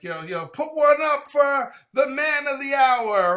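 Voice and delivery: high, loud, slow rallying toast; celebratory toast. Acted. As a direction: hail the guest of honour to a crowd. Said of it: "Everybody says".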